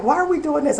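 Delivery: put-on high-pitched little kid question; imitated puzzled child. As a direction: ask it like a puzzled six-year-old. Voicing her six-year-old son.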